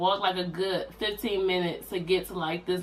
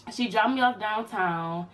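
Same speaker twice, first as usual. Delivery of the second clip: ordinary talk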